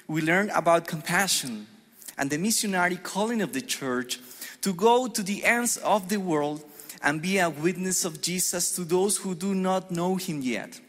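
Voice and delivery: ordinary delivery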